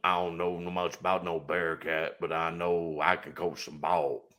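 low raspy Coach O impression; raspy smoker's voice. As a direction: growl it out like an old football coach. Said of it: "I can do the low, raspy smoker's voice".

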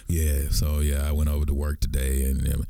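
very low, monotone mock-sexy host; podcast dude voice. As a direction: drop deep and drone like a smooth podcaster. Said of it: "dudes talking like this the whole time".

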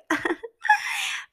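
squeaky burst of laughter; a high giggle at own joke. Real, not acted.

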